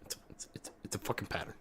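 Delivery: soft whispered aside, almost no voice; sarcastic whisper. Acted. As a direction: lean in and whisper the punchline. Sarcastic whispered payoff after loud line.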